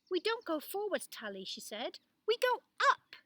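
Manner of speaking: high, loud eager exclaim; girl thrilled at her idea; excited discovery. Acted. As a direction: announce the solution with glee.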